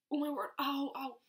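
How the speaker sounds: reliving the cramp; pained ow. Retold or imitated. In the shop, pained cries about her stomach. Retold pain in store.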